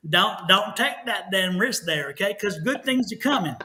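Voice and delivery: ordinary delivery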